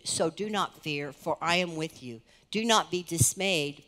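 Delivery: ordinary talk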